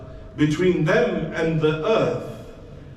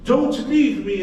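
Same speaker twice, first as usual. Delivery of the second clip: higher, louder cry of begging; louder desperate plea; 11 semitones higher, 6 dB louder than usual. Acted. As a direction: push it up into a cry. Trapped man begging.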